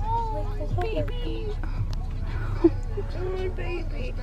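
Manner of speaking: very high, drawn-out coo. Real, not acted. Owner fussing over a new kitten, cooing. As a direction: melt over a tiny kitten.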